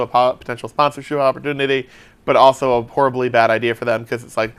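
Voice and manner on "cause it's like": usual speaking voice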